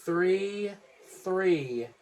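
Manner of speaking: high, loud pin count. Real, not acted. Fan counting the winning pin at the screen, loud shout. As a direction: call the three like the ref.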